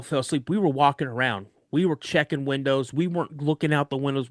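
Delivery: ordinary talk, nothing marked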